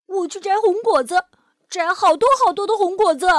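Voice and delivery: high, loud, fast and eager; little dinosaur dashing off, thrilled; excited child. Acted. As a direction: bubble over with eagerness.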